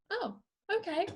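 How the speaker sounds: put-on a light childlike oh okay; satisfied child. Child accepting the explanation, lightly voiced childlike. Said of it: "then they're like".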